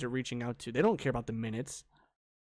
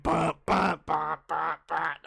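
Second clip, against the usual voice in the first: blaring "bah" imitation of intro music; loud mock intro jingle; 3 semitones higher, 6 dB louder, slower than usual. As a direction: punch out each bah like a horn. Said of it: "because it's so loud".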